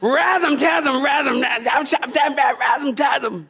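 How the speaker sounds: high, shouted gibberish swearing; mock cursing. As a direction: rattle off nonsense cuss words in a comic fit.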